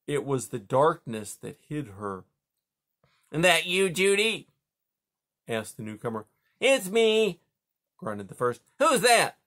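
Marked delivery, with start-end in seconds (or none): 3.3-4.5 higher, louder gruff beaver voice; a sleepy beaver calling across the pond. Acted. 6.6-7.4 higher, louder gruff beaver voice; a sleepy beaver calling across the pond. Acted. Beaver dialogue. 8.8-9.4 higher, louder gruff beaver voice; a sleepy beaver calling across the pond. Acted. Beaver dialogue.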